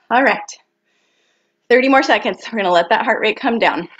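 ordinary delivery

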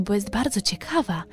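plain reading voice

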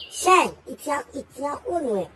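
ordinary talk, nothing marked

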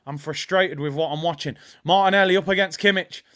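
ordinary talk

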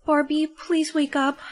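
ordinary talk, nothing marked

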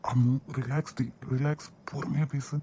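soft, gentle calming of a crying wife; soothing. Acted. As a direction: hush her tenderly. (மென்மையாக ஆறுதல்)

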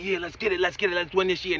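mock their early-year hype: let's get it; quoting their eager New Year energy. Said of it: "Before you was all up on it".

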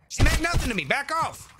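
high-pitched, hammed-up panic; mock frantic protest. Gamer fending off attackers in game, mock frantic protest. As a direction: plead loudly, then warn them off high.

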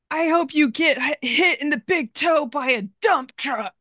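high, put-on mock curse at another driver; mock road rage. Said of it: "a very verbal rage driver".

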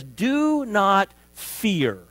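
very slow, weighty; solemn command. Acted. Preacher quoting God through the prophet, very slow. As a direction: draw out each word gravely.

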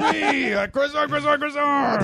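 very high squeaky monster voice; croissant monster. Pretend. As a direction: squeal like a silly pastry creature. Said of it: "croissant monster".

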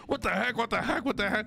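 high, incredulous repeated cry; high-pitched mock rage; malding disbelief. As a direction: squeak it out like you just lost. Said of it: "I'm like".

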